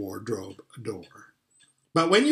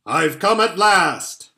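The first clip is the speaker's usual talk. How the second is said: slow, loud, grand announcement; Father Christmas; 5 semitones higher, 8 dB louder, slower than usual. Acted. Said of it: "said he".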